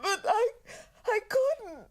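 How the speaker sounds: sobbing out the words; weeping through a plea for help; crying. Acted. Distraught mother breaking down.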